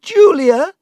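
loud, high-pitched cry for help; high, urgent shout; shouted call. Acted. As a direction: call out sharply to someone in another room. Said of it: "she shouted".

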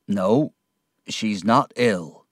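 slow, heavy answer; said slowly. A narrator's reading. Ham answers the narrator about Emily, slowly. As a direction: draw the words out slowly. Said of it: "Ham said slowly".

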